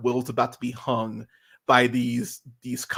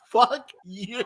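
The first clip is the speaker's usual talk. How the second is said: high-pitched retold outburst at the TV; mock yell; 10 semitones higher than usual. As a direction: curse the screen in mock outrage. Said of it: "I was yelling at the TV".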